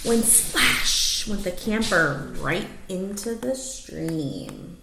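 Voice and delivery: lively rhyming read-aloud; story narration. Acted. Reading a rhyming picture book to the dogs, lively storytelling. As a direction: read it like a bedtime picture book, bouncing the rhymes.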